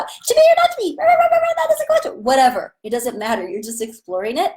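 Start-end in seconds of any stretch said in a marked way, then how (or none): 0.0-2.2 very high, loud yapping voice; shrieky high chihuahua bark. Shown as a demonstration. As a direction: yap it like a tiny dog. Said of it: "Or like you're a chihuahua".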